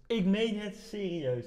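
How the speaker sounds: quiet, drawn-out insistence; hushed earnest repeat. Real, not acted. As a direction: drop the volume and stretch it out in earnest. Repeats it slowly, much softer.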